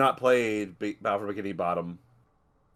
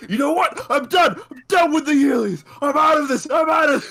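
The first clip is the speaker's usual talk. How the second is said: high, loud shouting exit; fake angry storm-off; 12 semitones higher, 6 dB louder than usual. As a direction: storm out in mock fury. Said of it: "that was just a bit".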